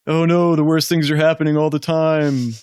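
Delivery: hammed-up panicky worrier; mock Whitaker. Mocking Whitaker's constant worrying to the party. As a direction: whine it like the fretful friend. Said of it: "You know him".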